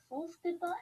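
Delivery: ordinary talk, nothing marked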